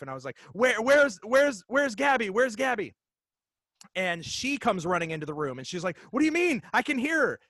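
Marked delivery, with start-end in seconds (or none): 0.5-2.9 high, loud frantic calling out; panicked yell, retold. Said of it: "I'm yelling for, for my wife". 6.1-7.4 high, loud urgent reply; alarmed wife, retold.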